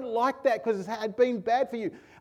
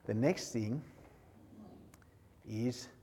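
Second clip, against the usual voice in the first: hushed sermon voice; 10 semitones lower, 11 dB quieter than usual. Acted. Preacher drawing the congregation close, low and hushed. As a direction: lower and softer, intimate preaching.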